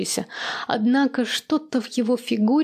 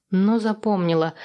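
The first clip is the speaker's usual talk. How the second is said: usual speaking voice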